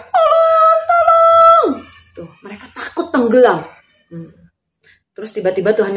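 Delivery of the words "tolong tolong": very high, drawn-out wail; acted high cry; crying out for help. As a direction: scream for help as a panicking crowd. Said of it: "Semua orang teriak".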